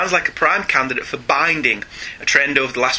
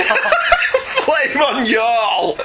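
laughing mock Kentucky drawl, higher; mocking Kentucky accent; 9 semitones higher, much slower than usual. Host mocks the co-host's attempt at a Kentucky accent, laughing. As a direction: tease the friend's attempt, giggling through it. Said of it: "Was he from Kentucky all of a sudden?".

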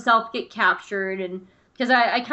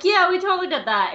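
higher-pitched mock boast; acted boast; 8 semitones higher, 3 dB louder than usual. Anakin claims they totally did it, imitated high boast.

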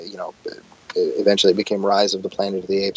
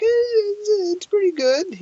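squeaky-high, unconvinced self-justifying; mock yourself defending it in falsetto; strained higher pitch; slower than usual. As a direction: voice shooting up as you talk yourself into it. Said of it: "your voice gets higher pitched as you try to".

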